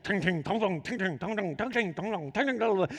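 sing-song metallic ting-tong; quick alternating clanks of metal tumbling round; imitated dryer clanging. Preacher mimicking gear banging in the dryer, imitated clanging. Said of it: "you just hear, like".

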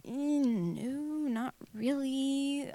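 slow, hedging no; reluctant doubt. Retold or imitated. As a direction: stretch it out, unsure and apologetic. Retells her reluctant, doubtful answer.

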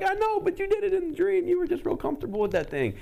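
very high, loud, insistent voice; animated self-quote. Retold or imitated. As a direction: press the point in a high falsetto. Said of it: "I'm like".

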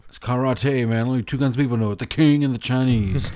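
quoted film line. Retold or imitated. Quoting a film scene.

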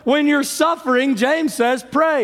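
high, insistent sermon crescendo; impassioned preaching. Acted. Preacher telling the congregation to pray when suffering or sick, impassioned. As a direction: preach it high and urgent, driving each point home.

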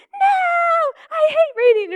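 hammed-up defiant kid yelling no; daughter's shout. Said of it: "she's like".

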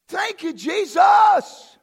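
loud, high, drawn-out holler; real exultant church cry; jubilant praise shout. Real, not acted. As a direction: shout thanks to heaven, stretching it slowly.

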